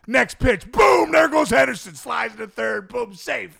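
high, loud call of the steal; high, excited play-by-play burst. Retold or imitated. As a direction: shout it like a play-by-play man. Calling Henderson's steals.

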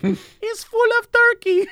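high, drawn-out pleading woman; poor little woman. Retold or imitated. Worker with little English answers turkey complaint, poor little woman. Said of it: "This poor little woman's just like".

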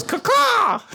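loud high crow caw. Pretend. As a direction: shout it like a cawing crow. Imitating a crow call.

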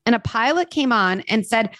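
ordinary talk

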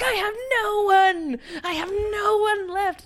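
high, hammed-up whimper; mock despairing wail. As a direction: wail it like a melodramatic teen. Mocking whiny despair.

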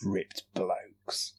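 imitating a lusty tone, very softly; mock-lusty, hushed echo. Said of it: "in a very lusty way".

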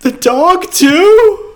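mock horror that the dog dies too; mock outraged shock. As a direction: shriek it in hammed-up horror, half laughing. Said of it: "This me's like".

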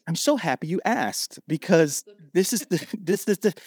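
eager. Pretend. Ideal eager answer to celebrity.

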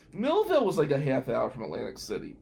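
usual speaking voice

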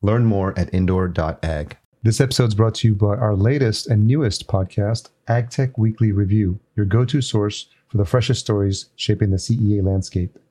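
loud, polished sponsor read; punchy promo voiceover with crisp emphasis; podcast ad read. Real, not acted. Host reading conference and podcast promos to listeners, ad read.

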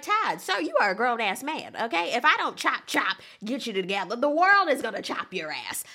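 loud sassy scolding; scolding quote. Retold or imitated. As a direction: tell him off like a stern auntie. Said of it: "I'm about like".

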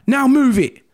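high, loud sharp command; shouting order. Retold or imitated. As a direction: snap the order at him. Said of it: "She's shouting".

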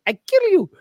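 very high, loud puppet catchphrase; Ahmed. Retold or imitated. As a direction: squeal it like a comic puppet threat. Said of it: "she loves the way she says".

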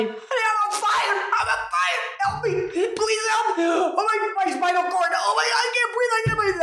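high-pitched panicked screams for help; re-enacted screaming. Swimmer reliving her screams to Bonnie from the burning sting, screaming. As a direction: cry out in agony, voice shooting far up. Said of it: "I was screaming to Bonnie".

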